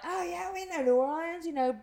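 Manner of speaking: high-pitched imitation, no real accent; lightly mocked foreigners, pitched well up; imitating Irish bar patrons. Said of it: "they said in their accent".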